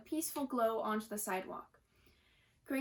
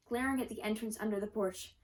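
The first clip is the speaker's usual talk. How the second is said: ordinary delivery, nothing marked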